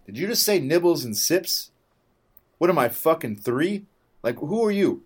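high, loud, outraged disbelief; indignant outburst. Retold or imitated. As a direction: fire it back at her, appalled. Retold indignant reply to attendant.

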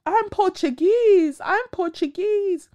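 high, slow, drawn-out insistence; prissy refusal to be called African; mock insistent denier. Mocking a woman who denies her Angolan roots. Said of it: "I've met people who were like".

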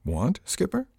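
startled high question; surprised. Acted. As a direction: caught off guard, pitch jumping up.

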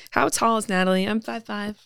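usual speaking voice

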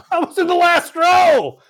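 high, loud, slow laughing protest. Real, not acted. Defending himself to teasing teammates, with a laughing shout. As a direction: shout it back through laughter.

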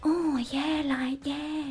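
high, soft breathy fake moaning; fake porn star moan. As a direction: coo like a cheesy porn star. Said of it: "I personally don't like the fake".

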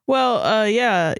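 usual speaking voice

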